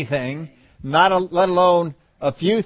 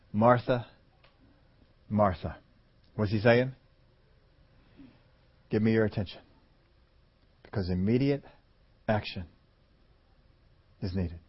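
much softer, lower gentle call; gentle address; 6 semitones lower, 6 dB quieter than usual. Acted. Jesus gently speaking to worried Martha, soft and low. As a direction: draw her in quietly.

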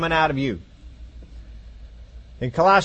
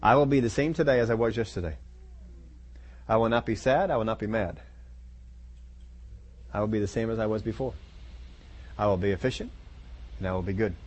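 low, soft, steady affirmation to yourself; mirror self-talk; 6 semitones lower, 8 dB quieter than usual. Pretend. Preacher voicing a believer telling their mirror self, low and soft. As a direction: murmur the vow low and even into the mirror. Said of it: "look yourself in the mirror and say".

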